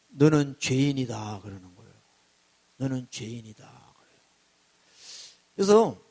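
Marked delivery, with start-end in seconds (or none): 0.1-1.4 low, slow voice rising from deep inside; inner voice from below. Acted. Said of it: "밑에서부터 음성이 들리는데". 2.8-3.6 low, soft voice from the belly; inner voice from below. Acted. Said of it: "밑에서부터 음성이 들리는데".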